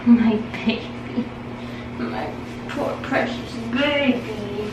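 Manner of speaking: slow, tender comforting voice; soothing pet talk. Real, not acted. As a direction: comfort the dog gently. Soothing dog after too much soap.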